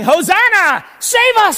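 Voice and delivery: high, loud crowd cry of hosanna; crowd shouting praise. Retold or imitated. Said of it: "we're shouting".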